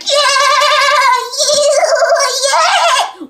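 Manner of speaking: very high, loud mock squeal; imitating an excited kid. Classmate hyped to find her, very high mock squeal. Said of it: "He's like".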